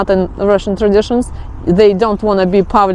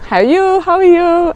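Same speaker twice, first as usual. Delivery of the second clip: quick, high, casual How are you? How are you?; high, chirpy American small talk in passing; breezy American greeting; 9 semitones higher than usual. Retold or imitated. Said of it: "you think that it's, like, common".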